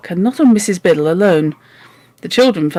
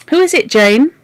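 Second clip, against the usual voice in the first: put-on high, sour old-lady question; 7 semitones higher, 3 dB louder than usual. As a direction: ask it with sour suspicion. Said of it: "she asked in a sour voice".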